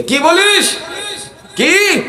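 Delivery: high, acted indignant cries; angry outburst. Abu Jahl reacting to the Prophet's words, acted angry cries. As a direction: fire back two outraged questions. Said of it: "Abu জাহেল এরকম একটা ভাষা প্রয়োগ করেছে".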